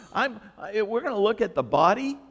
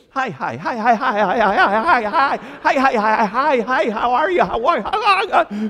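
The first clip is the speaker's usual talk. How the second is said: rapid, louder hi hi hi to everyone; overeager greeting; 3 semitones higher, 7 dB louder than usual. Retold or imitated. Pastor greeting every member after the complaint, louder frantic greeting. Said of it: "I was like this".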